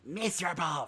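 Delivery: lightly squealed weasel voice; a small squeal of scorn. A narrator's reading. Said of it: "squealed the weasel".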